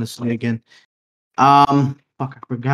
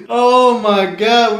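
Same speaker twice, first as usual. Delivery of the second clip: laughing hard; 10 semitones higher, 4 dB louder, much slower than usual. Real, not acted. Cracking up at the joke.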